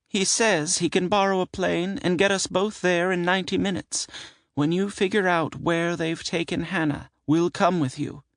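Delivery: high aunt voice, Hero said trustingly; proud of her friend Hero; woman voice. Acted. Sybil voiced by narrator.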